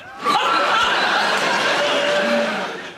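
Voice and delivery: loud, high whooping laugh; loud, high cackle of laughter; high shrieking laugh. Acted. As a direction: shriek with delight at your own joke. Shrieking laugh at own joke.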